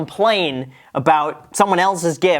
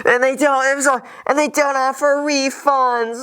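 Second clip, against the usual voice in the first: mock crying; 12 semitones higher than usual. Pretend crying over the bill.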